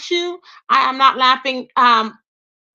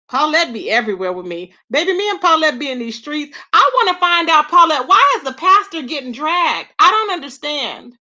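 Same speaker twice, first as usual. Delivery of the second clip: ordinary delivery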